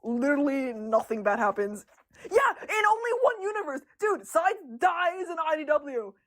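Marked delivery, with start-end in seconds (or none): 2.3-6.1 exasperated shout. Real, not acted. Artist protesting to viewers about doomed characters, exasperated shout.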